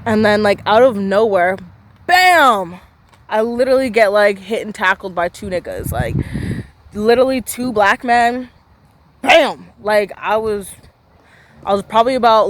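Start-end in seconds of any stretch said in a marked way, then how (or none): 2.1-2.8 loud, high burst of "bam"; exclaimed impact. Retold or imitated. Storyteller acting out being tackled out of nowhere, sudden loud exclamation. 9.2-9.7 loud, raised "bam"; exclaimed impact. Retold or imitated. Storyteller reliving the tackle by two players, loud exclamation. Imitates the tackle a second time.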